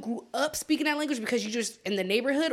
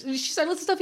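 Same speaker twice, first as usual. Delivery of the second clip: ordinary talk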